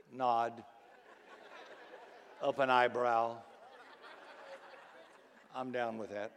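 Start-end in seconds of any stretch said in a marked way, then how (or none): 5.6-6.3 soft, low, barely-there agreement; restrained formal approval. Acted. Formal churchgoer answering the sermon, hushed and restrained. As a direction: understate it like a nod at an auction. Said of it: "sort of like a high-class auction".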